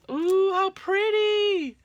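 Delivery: high, loud, slow ooh of wonder; delighted gasp. Real, not acted. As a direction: gasp then linger on pretty. Finding pretty items in package.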